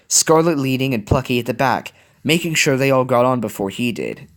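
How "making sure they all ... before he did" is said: usual speaking voice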